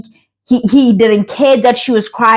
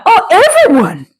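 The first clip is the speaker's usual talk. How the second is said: high-pitched mock questioning; acted curious reaction; 8 semitones higher than usual. Acted reaction in retold conversation.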